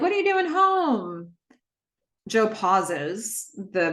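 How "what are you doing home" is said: lifted, surprised-sounding query; high-pitched questioning quote. Retold or imitated. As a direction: ask it way up high, stretching "home". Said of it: "Cam's like".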